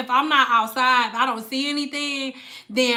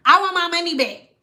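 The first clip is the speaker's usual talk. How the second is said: higher-pitched mock complaint; retold demand; 6 semitones higher, 3 dB louder than usual. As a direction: imitate an insistent unhappy customer. Retold customer demanding a refund.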